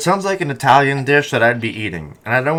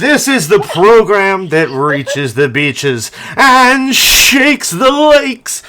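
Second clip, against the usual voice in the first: very slow, louder and rising announcer hype; announcer intro; 6 semitones higher, 8 dB louder, much slower than usual. Acted. Host hyping the show's opening in a big slow announcer voice. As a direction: stretch every word like a hype man. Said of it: "Welcome to the Praxcast".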